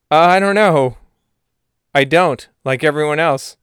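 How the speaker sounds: hammed-up vague sidekick; put-on friend voice. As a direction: play a clueless pal. Salamander answers in the skit.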